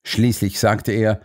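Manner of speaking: plain reading voice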